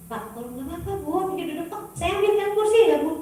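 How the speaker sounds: fake-cheerful courtesy through clenched patience; overly sweet hospitality, climbing in pitch; forced politeness. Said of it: "rasanya saya pengen lempar dengan kursi".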